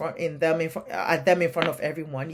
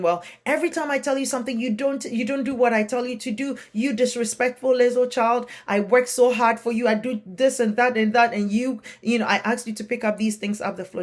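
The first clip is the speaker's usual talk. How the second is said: loud, higher, fast scolding; mock yelling parent; 6 semitones higher, 4 dB louder than usual. Shown as a demonstration. Parent yelling at child for leaving items on floor, angry scolding. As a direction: rattle off a furious telling-off. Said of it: "Instead of yelling and saying".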